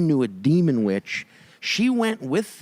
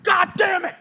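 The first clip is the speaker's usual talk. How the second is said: mock fan rage. Angry fan swearing at game.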